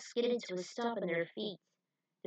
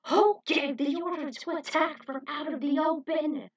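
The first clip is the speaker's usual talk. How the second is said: loud, higher furious demand; angry general; 7 semitones higher, 6 dB louder than usual. Acted. General angrily demands who gave the order. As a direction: chew him out. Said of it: "as angry as ever".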